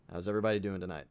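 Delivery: ordinary talk, nothing marked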